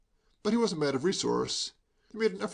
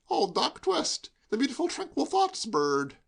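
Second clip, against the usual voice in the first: high, slow gleeful recognition; young Hugo, gleeful; 9 semitones higher, slower than usual. A narrator's reading. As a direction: savour spotting an old foe.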